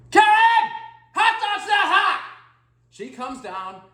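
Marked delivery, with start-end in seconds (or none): 0.1-2.2 loud high shout calling upstairs; yelling for Karen. Retold or imitated. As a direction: holler it up the stairs. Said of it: "I went".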